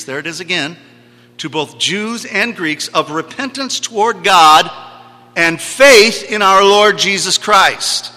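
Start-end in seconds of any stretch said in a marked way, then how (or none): 1.4-8.1 louder, higher, forceful proclamation; declaimed scripture. Real, not acted. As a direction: build to a slow, loud finish. Preacher declaims scripture to congregation.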